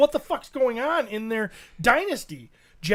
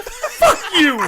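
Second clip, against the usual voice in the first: higher, louder angry yell; the alpha yelling at the troop; mock chimp fury; 7 semitones higher, 4 dB louder than usual. Alpha chimp charging down, mock furious yell. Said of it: "He's like".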